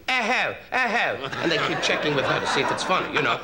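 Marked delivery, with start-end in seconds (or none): 0.1-1.2 high, imitated British hooting; mock audience hoots. Imitating guests laughing at the act, high hoots. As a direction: hoot it high like a tittering crowd. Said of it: "they're all going".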